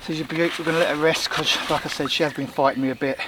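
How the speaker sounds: out of breath, gasping between words; breathless after a struggle. Real, not acted. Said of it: "I'm out of breath".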